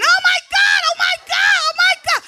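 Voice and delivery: high, loud panicked squeal; hammed-up high scared squeal; girly shriek. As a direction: squeal like you just saw a mouse. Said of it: "all the girl in me came out".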